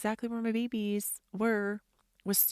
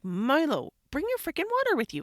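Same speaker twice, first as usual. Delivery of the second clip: loud, high, quick nagging command; exasperated order; 8 semitones higher, 6 dB louder than usual. Retold or imitated. Mom ordering son after he refused, exasperated. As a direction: re-enact a parent losing patience.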